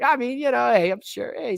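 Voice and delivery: ordinary talk, nothing marked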